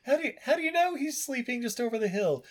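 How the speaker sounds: put-on very high boy voice; high-pitched naive query; questioning Mowgli. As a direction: ask like a puzzled cartoon child.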